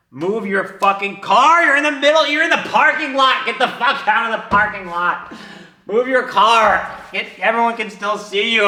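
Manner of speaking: loud, high commanding voice; reenacted frantic scolding; urgent yelled orders. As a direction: yell at yourself to get moving now. Inner voice yells orders at him.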